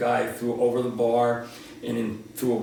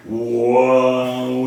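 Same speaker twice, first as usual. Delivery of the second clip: startled whoa; 3 semitones lower, 5 dB louder than usual. Retold or imitated. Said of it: "It was like".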